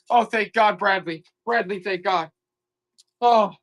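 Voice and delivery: normal talking voice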